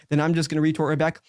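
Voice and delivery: ordinary delivery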